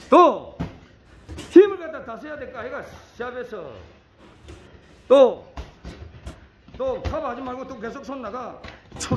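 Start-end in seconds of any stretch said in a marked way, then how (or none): 0.1-1.8 loud one-word shout; loud bark. Real, not acted. Coach shouting final push. 5.1-5.5 loud one-word shout; loud bark. Real, not acted. Coach shouting final push.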